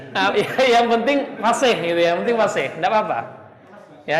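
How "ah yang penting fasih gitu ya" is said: laughing through the words, higher and quicker. Real, not acted. Teacher laughing while reassuring students about spitting. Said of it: "teacher laughs while reassuring".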